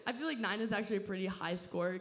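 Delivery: usual speaking voice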